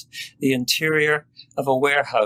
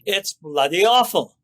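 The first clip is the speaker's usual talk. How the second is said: loud, higher angry roar; 7 semitones higher, 3 dB louder than usual. A narrator's reading. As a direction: bellow it out in frustration. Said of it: "his voice roared".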